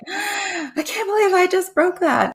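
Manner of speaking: high, drawn-out mock wail of regret; retold dismay in a high, slow voice; upset. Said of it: "I was really upset later".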